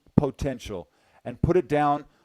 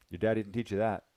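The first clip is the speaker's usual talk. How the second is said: ordinary talk, nothing marked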